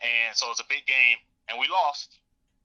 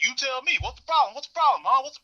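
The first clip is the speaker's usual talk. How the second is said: angry retort; 10 semitones higher than usual. Retold or imitated. Father retorts in heated argument.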